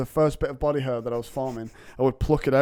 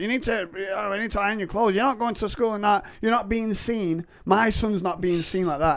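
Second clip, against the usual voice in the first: higher-pitched fussy mum voice; imitating mum; 7 semitones higher than usual. Mum refusing to send her son to school scruffy, higher fussy voice. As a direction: fuss like a mum ironing clothes at the door. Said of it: "She'd be like".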